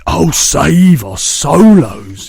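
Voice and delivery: loud, slow wailing cry; anguished cry. A narrator's reading. BFG lamenting the nightmare, loud wail. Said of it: "he said, cried".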